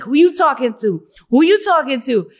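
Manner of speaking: loud, high confrontational imitation of a drunk friend; Karina Correa. Imitating drunk friend pushing her, loud challenge. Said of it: "every time I would imitate her".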